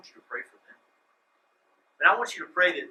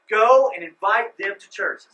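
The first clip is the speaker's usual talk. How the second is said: mock command; 3 semitones higher, 11 dB louder than usual. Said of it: "He says".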